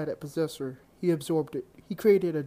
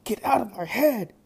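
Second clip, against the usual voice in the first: higher, louder yell at the voice; 6 semitones higher, 6 dB louder than usual. A narrator's reading. Drake yelling at the demon in his head, loud yell. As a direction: shout the demon out of your head. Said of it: "Drake yelled".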